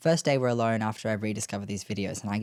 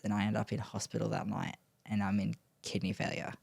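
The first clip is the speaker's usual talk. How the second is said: ordinary delivery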